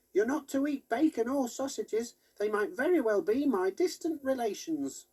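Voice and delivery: high squeaky toy pig, put out. A narrator's reading. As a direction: play the knitted pig toy, a bit offended. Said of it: "Biscuits made Dog Hog say".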